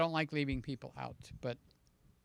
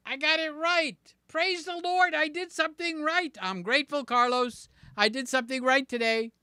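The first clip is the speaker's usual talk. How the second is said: high, loud real jubilation; triumphant; 8 semitones higher, 4 dB louder than usual. Real, not acted. Streamer thrilled to have remembered right, triumphant. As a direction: celebrate it in a raised, excited voice. Celebrates remembering the state correctly.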